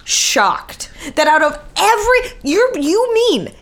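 high-pitched, incredulous protest; shocked. Real, not acted. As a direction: push the pitch up in disbelief, stretch EVERY SPORT. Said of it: "I am shocked".

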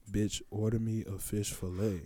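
very soft, low menacing man; deep voice. Retold or imitated. Big man insists after her refusal, deep quiet voice. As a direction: imitate a big man's low, hushed demand. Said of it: "Like, deep ass voice".

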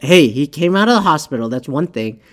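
usual speaking voice

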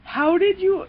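stunned. Retold or imitated. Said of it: "you're just like".

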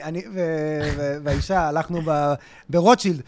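ordinary delivery

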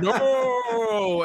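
high, very drawn-out mock wail; Darth Vader. Podcaster imitating a famous movie line, mock anguished cry. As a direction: stretch the vowel into a hammed-up cry of anguish. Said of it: "Vader's famous".

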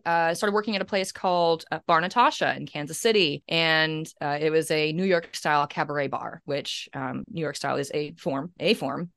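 ordinary talk, nothing marked

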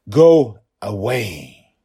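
slow, loud, worn-down shout; a desperate father's weary loud command. A narrator's reading. Forest still refuses to vanish.

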